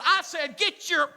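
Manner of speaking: put-on losing patience with the kids after apologizing; exasperated yell. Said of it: "She turns around and said".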